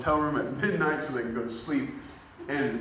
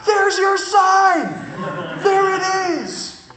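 triumphant; 9 dB louder, much slower than usual. Retold or imitated. The student's sign arrives.

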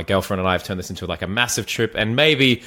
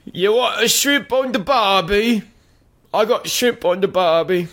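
much higher, broad mock Australian accent; hammed-up Australian accent; 12 semitones higher than usual. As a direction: ham up the Australian cliché. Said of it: "they don't like the Australians".

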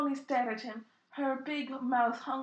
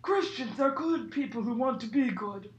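mock wise Hagrid line; 6 dB louder than usual. Shown as a demonstration. Reading Hagrid's line to friends, louder solemn voice. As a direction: deliver the wisdom with heavy weight. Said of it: "No, that's not the line".

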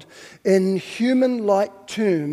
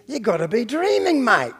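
broad, loud Aussie exclamation; big incredulous Aussie voice, dropping at the end; Australian accent; 6 semitones higher, 6 dB louder than usual. Acted. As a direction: put on a hammed-up Australian accent, high and loud. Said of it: "putting on his best Australian accent".

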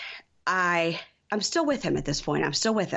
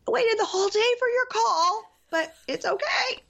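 high, put-on hurt voice, then slower; mock wounded Darcy. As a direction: voice of a wounded woman swallowing her hurt. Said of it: "And she goes".

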